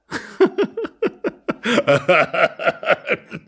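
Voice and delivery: long, high fit of laughter; helpless laughter. Acted. Laughing at the doubting husband, helpless. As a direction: burst out laughing and keep going.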